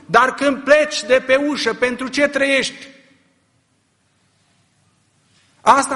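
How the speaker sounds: high, loud rising sermon cry; preacher driving home a rebuke; fiery preaching. Real, not acted. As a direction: press the question hard on the crowd. Preaching at full force.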